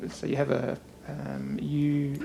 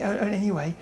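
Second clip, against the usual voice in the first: ordinary talk, nothing marked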